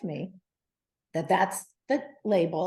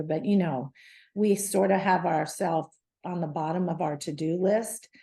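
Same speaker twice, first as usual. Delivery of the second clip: ordinary delivery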